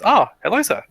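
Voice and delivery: a flustered guard snapping to attention; startled guard. Acted. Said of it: "he looks at you and goes".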